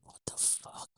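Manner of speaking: voiceless, stunned whisper; shocked whisper. Retold or imitated. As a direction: breathe it out in disbelief. Shocked reaction quoted to self.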